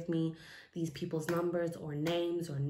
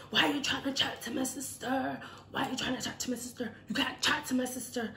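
higher-pitched imitation of an angry challenge; acted angry confrontation; 7 semitones higher, faster than usual. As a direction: confront him in a raised, put-on voice. Retells attacker's angry confrontation.

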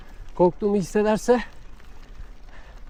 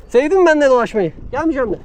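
higher, louder playful taunt; teasing; 6 semitones higher, 6 dB louder, faster than usual. Real, not acted. Rider teasing the dog that followed him, loud and playful. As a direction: tease the dog like a buddy.

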